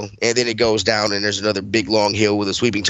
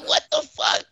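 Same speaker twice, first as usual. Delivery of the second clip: mock squeal. Retold disbelief at dad.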